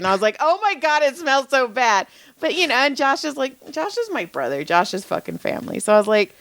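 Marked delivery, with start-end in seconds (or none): 0.4-2.1 higher, complaining about a stink; retold disgust. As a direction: recoil from the smell, voice raised in pitch. Retold disgust at friend's fart.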